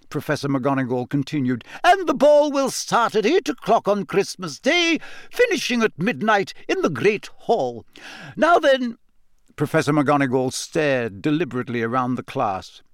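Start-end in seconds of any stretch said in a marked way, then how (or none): 1.8-9.0 higher, crisp stern schoolmistress; a strict old teacher laying down the rules; McGonagall's voice. A narrator's reading. McGonagall announcing the ball to her class, stern teacher voice. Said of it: "McGonagall continues her announcement".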